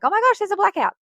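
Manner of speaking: high, loud mock alarm; waking up startled in the dark. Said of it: "I'm like".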